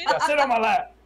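high loud silly order; mock command. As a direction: bark it like a bossy pilot.

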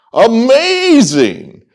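high, drawn-out burst of delight; elated exclamation. Real, not acted. Fan rejoicing that baseball is back, elated.

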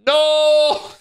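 high, loud, drawn-out wail; hammed-up scream of despair; anguished scream. Mama rabbit as her child is taken, anguished scream.

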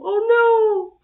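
high, loud cry of dismay; alarmed cry. Real, not acted. Watching a poor baby get thrown, alarmed cry.

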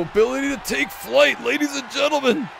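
high hype announcer call. Pretend. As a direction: announce it to the crowd.

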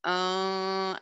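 ordinary delivery

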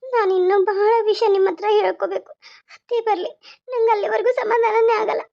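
upset, inconsolable crying voice; tearful. Acted. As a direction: speak through sobs. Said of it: "ಕಣ್ಣೀರ್ ಒರ್ಸ್ಕೊ ಮೊದ್ಲು". (ಕಣ್ಣೀರಿಡುತ್ತಾ ಮಾತು)

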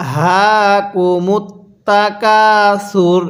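the whole verse drawn out slowly; slow demo recitation. Shown as a demonstration. Teacher recites the verse slowly for students to repeat. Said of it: "আরেকবার পড়ুন".

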